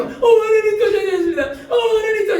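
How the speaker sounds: high, loud mock wailing to God; mock pleading. Preacher mimics a believer confessing the same sin again, mock pleading. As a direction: plead like an overdone penitent, high-pitched.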